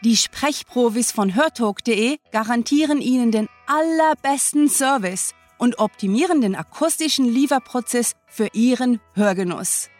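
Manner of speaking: normal talking voice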